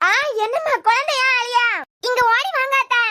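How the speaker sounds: high, urgent calling for help; alarmed cry. Acted. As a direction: call auntie over in a panic. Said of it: "அத்தை அத்தைன்னு அலறுன".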